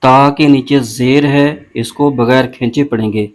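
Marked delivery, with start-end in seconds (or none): none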